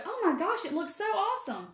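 high, gushing "oh my gosh" at the finished house; mock delighted gasp. Coming home to a house someone else painted, mock delighted. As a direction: pretend to walk in thrilled by the paint job. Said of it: "come home and go".

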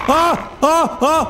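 sharp high screams of fright; frightened screams. Real, not acted. Gamer jolted by the game's jump scare, screaming in fright.

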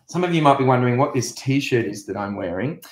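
normal talking voice

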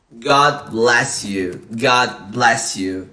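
drawn-out, careful pronunciation demo; slow teaching model. Shown as a demonstration. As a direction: spell it out word by word for learners. Teacher models phrase to repeat.

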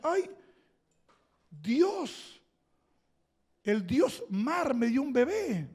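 high, wondering cry, slowed; surprised princess. Retold or imitated. Pharaoh's sister finds the baby in the basket, voiced in a high, amazed tone. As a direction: marvel at a baby found in the river. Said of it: "la hermana de faraón dice".

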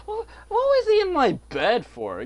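shrieking high in disbelief; outraged high yell. Real, not acted. Gamer outraged a villager slept in his bed, shrieking. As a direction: screech the question in outrage.

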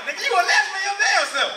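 loud laughing jab; laughing tease. Real, not acted. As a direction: tease them while laughing. Loud laughing jab at audience member.

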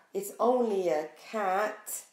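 ordinary delivery, nothing marked

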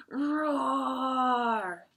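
drawn-out mock tiger roar; playful roar to startle kids. Tiger appears in the story.

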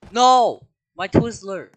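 high, loud cry of loss; anguished yelp over a lost snack; dismayed shout. Real, not acted. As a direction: yell after the falling Twizzler. Loses the Twizzler.

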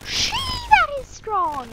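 very high, stretched "shee"; loud, high-pitched astonishment; amazed exclamation. Real, not acted. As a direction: squeal slowly in amazement. Amazed at weapon.

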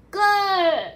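very loud, high, drawn-out GO; story yell. Acted. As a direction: yell it out at full voice. Story shout after the scream.

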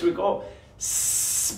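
long, slow whispered hiss like a snake; mock snake hiss; snake hiss demo. Shown as a demonstration. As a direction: hiss it out for the kids. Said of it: "let me hear snakes".

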